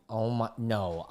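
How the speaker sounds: ordinary delivery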